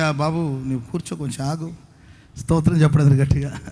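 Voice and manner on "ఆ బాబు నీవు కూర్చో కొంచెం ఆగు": soft, low, calming the crowd; quiet and hushed, easing them down; gentle calming. Retold or imitated. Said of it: "ఆ దైవజనుడు అన్నారంటే".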